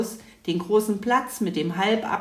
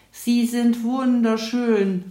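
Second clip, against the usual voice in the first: louder and slow, full of wonder; delighted exclamation; 5 semitones higher, 5 dB louder, slower than usual. A narrator's reading. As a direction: call it out in awe at the reindeer. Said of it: "rief Mathilda".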